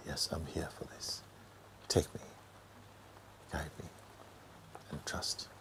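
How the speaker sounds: hushed inward yes; inner surrender. Shown as a demonstration. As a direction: breathe it inward like a quiet vow. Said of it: "Just say yes inside".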